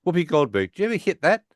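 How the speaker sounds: high, sly mock Howard; cheeky Howard question. Retelling Howard cornering Wheaton, a high sly mock question. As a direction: toss the crude question up high and innocent.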